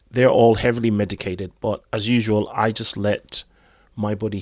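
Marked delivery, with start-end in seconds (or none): none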